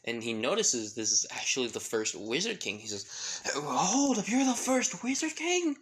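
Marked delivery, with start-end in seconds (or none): 3.5-5.8 loud, high-pitched shocked outburst; comic astonishment. Acted. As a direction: blurt it out in hammed-up disbelief. Asta realizes who he meets, comically.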